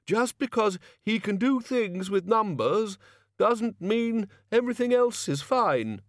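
high, slow objecting academic; high-pitched donnish quibbling; peevish Chair of studies. Acted. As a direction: protest in a high, drawn-out character voice.

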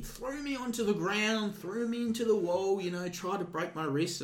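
put-on high, slow whiny drunk-woman voice; mock drunk woman. Woman tells bouncer about the guard, whiny and slow. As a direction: ham up her outraged complaint, slowly.

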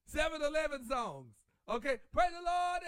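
ordinary delivery

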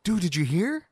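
high, loud rush of big news; excited coworker. Retold or imitated. Coworker running up to the speaker with news, excited. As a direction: burst in with breaking news, imitating a coworker. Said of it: "my coworker comes up and he's like".

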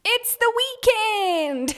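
long, high, loud whoop of excitement; excited cheer. Real, not acted. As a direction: shout the big news, stretched out and high. Reveals an exciting secret.

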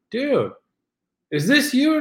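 high, loud pretend disbelief; mock astonished friend. Imagined friend confronts him over a post, mock astonished. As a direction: act out a stunned buddy's question.

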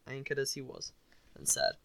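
ordinary delivery

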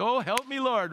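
strained prayer. Retold or imitated. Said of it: "you try to be holy".

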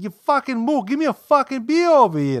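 put-on old man barking for a beer; loud Yankee demand. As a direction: yell it like a crusty old Yankee. Imitating Dick yelling at waitress.

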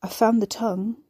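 usual speaking voice

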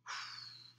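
big heavy weary exhale; dramatic sigh. Real, not acted. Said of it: "Big sigh again".

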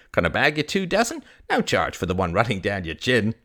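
high, animated sales patter; old fruit vendor, cheerful. Acted. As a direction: reel off a lively market pitch.

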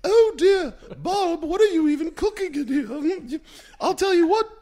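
put-on very high, loud warbling Julia Child voice; bad Julia Child impression. Host reacts to an Easy Bake Oven as Julia Child, high and loud. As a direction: hoot it up high like a tipsy TV chef. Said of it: "your reaction to Julia Child seeing an Easy, Easy Bake Oven as your bad accent".

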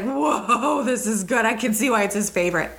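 reliving a pleasant discovery; put-on wowed viewer voice; impressed reaction. Acts out her reaction to the show.

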